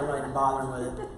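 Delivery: quick, low, laughing dismissal; laughing quote. Retold or imitated. Earl brushing off the roller coasters, quick and laughing. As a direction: toss it off fast through a laugh. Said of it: "Earl's like".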